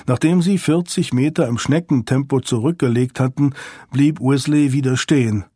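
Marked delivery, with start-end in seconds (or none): none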